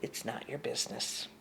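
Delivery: unvoiced whisper to the mind; a whispered firm dismissal. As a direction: hush it away in a whisper. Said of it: "I would just stop it with".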